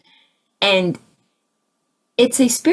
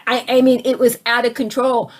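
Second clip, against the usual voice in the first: usual speaking voice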